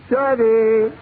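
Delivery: insistent acted hail; calling out. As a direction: call for attention, raising the voice. Customer calls bartender again.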